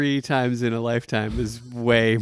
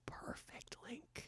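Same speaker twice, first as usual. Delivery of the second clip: whispering; 31 dB quieter, much slower than usual. Real, not acted. Awed reaction to casting idea.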